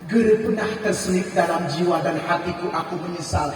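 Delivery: Prophet speaking gently. Acted. Husband reassuring his wife he has no regret, low and gentle. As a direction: reassure her, low and measured.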